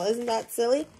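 plain reading voice

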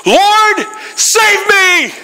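high desperate shout; screaming for help. Retold or imitated. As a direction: cry out to be saved. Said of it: "He screamed them out".

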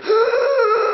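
sharp gasp like the wind knocked out. Shown as a demonstration. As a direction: gasp as if punched in the gut. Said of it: "you make that sound that's like".

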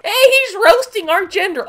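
high-pitched, loud mock complaint; put-on aggrieved squawk; whiny offended internet user. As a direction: mimic an upset Tumblr user in a squeaky voice. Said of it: "before you Tumblr people get sad".

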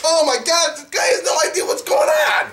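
high, incredulous outburst; exasperated shout. Pretend. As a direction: cry out at a hopeless guest. Interviewer loses patience with guest.

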